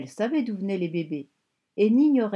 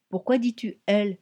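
plain reading voice

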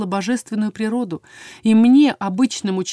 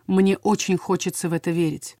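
ordinary delivery

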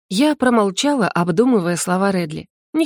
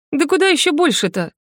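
outraged, pitched up high; indignant; 6 semitones higher than usual. A narrator's reading. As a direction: protest in disbelief. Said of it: "возмутилась я".

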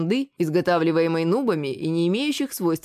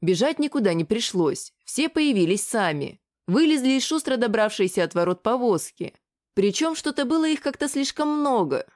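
ordinary delivery

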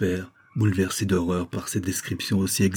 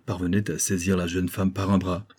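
ordinary delivery

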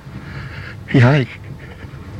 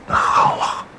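ordinary talk, nothing marked

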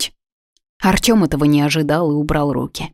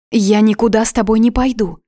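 higher, louder alarmed protest; frightened refusal; 6 semitones higher, 5 dB louder than usual. A narrator's reading. Woman refusing to leave with the man, frightened. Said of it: "Испугалась я не на шутку".

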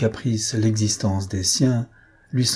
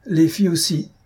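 higher, emphatic outcry; exclaiming with conviction; 8 semitones higher than usual. A narrator's reading. Bessèges chimes in against the de Praille girls, emphatic cry. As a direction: blurt it out with conviction. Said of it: "s'écria avec conviction Bessèges".

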